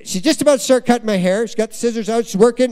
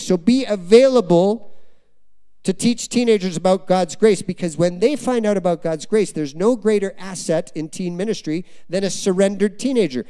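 ordinary delivery

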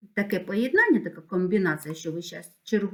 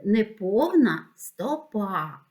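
slow, drawn-out naming of a term; deliberate term; much slower than usual. Shown as a demonstration. Teacher naming a new term to children, slow and deliberate. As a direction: spell it out slowly for the class. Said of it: "Це називається".

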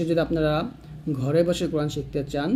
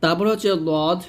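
normal talking voice

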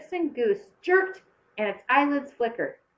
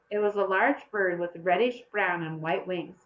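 plain reading voice

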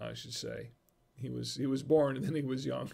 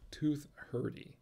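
"Tooth hurdy" echoed softer to himself; quiet repeat; 7 dB quieter than usual. Shown as a demonstration.

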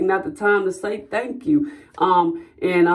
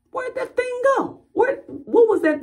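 much higher, frantic questions about the vanished bug; alarmed searching. Retold or imitated. Vlogger acting out the bitten sleeper, high alarmed questions. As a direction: hunt for the bug that just bit you. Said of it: "You're like".

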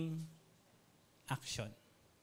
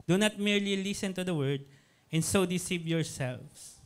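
scripture reading; 3 semitones higher, 4 dB louder than usual. Acted. Reading James verse.

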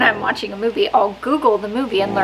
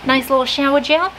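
higher, put-on Australian lilt ending on a rise; amateur Australian accent, pitched up; attempted Australian accent; 5 semitones higher than usual. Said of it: "the accents, I know".

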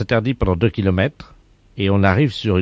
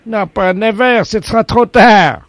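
louder, higher, hammed-up refusal; mock indignant protest; 11 semitones higher, 7 dB louder than usual. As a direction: play the stubborn old man who won't budge. Prank caller protests, getting louder. (protestation outrée et surjouée)

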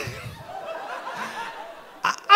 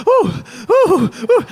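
mock moan; 4 semitones higher, 3 dB louder, much slower than usual. Retold own moaning.

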